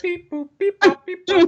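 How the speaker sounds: high beeping robot noises; toy robot voice. Pretend. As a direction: beep like a little toy robot. Mimics computer work, punching numbers.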